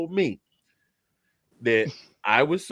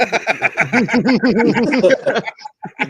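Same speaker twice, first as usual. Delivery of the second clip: big high burst of laughter; loud laugh; 10 semitones higher, 8 dB louder than usual. Real, not acted.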